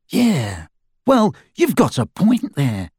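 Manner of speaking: a heavy, reluctant agreement; heavy concession. A narrator's reading. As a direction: grudgingly concede the point, weightily. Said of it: "said Dirk heavily".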